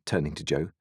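ordinary delivery

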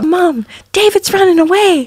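put-on very high-pitched kid calling out; imitating alarmed kid. Kids telling Mom their brother is running away, mock alarm. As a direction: mimic a child crying out the alarm to Mom.

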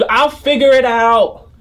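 put-on high, slow stubborn kid voice; high stubborn boy voice.

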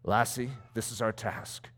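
a hint of a grave old mentor, quietly spoken; old missionary. Old missionary shares his dream with the lassie, lightly voiced.

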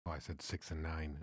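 usual speaking voice

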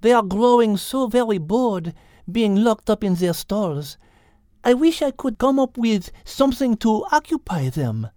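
high, light French-tinged cat voice; Liz the cat. A narrator's reading. Liz wishing the bored animals had something to do, lightly French.

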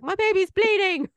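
put-on high, loud alarmed cry over the baby's nail. Mother to hurt daughter, high alarmed cry. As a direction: panic rising at the sight of blood.

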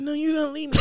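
high-pitched pleading; high mock-girlfriend plea; put-on girlfriend voice. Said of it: "I'm gonna stop doing the voice".

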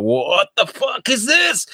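high, slow disbelieving exclaim; mock bewildered. Tourist swarmed by sellers, slow stunned disbelief.

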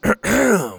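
sharp throat clear. Acted. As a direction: clear throat to get attention. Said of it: "Pa cleared his throat".

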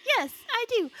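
high, bright cabin-crew answer; flight attendant voice. Pretend. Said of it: "flight attendant voice on".

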